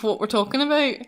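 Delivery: normal talking voice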